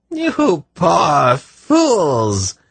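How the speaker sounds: high, very slow derisive rasp; harsh rasp. A narrator's reading. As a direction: rasp it out, stretched and scornful. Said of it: "rasped in a harsh voice".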